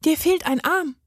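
put-on high child's voice, matter-of-fact; deadpan child observation; dry little girl. A narrator's reading. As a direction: point it out drily as a small girl. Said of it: "stellte sie trocken fest".

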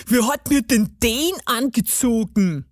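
put-on gnome voice, indignant. A narrator's reading. Gnome discovers the skirt he wears, indignant. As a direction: play a gnome taken aback.

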